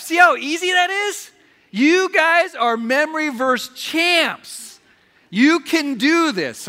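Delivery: loud, high, triumphant encouragement; big rallying praise, pitched high and slow; excited cheering. Real, not acted. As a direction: cheer them on like champions, stretching the words. Cheers congregation after memorizing verse.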